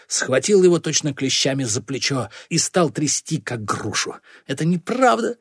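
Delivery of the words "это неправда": high-pitched, insistent denial; protesting. A narrator's reading. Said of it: "снова запротестовал Бакае". (отчаянный протест)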